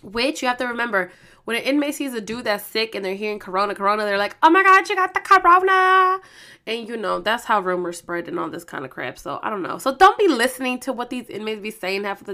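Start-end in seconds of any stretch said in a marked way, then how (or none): 4.4-6.2 high, loud alarmed yell; hammed-up shriek of a rumour spreader; mock panic. Inmates spotting a sick dude, mock panicked yell.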